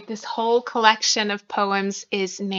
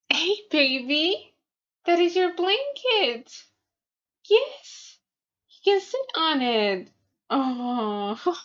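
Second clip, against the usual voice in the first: pet talk; 7 semitones higher, slower than usual. Real, not acted. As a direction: coo slowly at the kitty. Talking to her cat.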